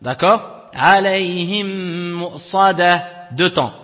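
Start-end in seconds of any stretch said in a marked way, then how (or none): 0.7-3.3 slow chant, alayhim with the mim merged and nasal for two beats into mu'sadah; tajwid recitation. Shown as a demonstration. Teacher shows the merged mim, slow chanted recitation. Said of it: "on dit ici".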